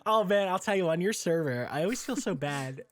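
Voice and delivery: ordinary talk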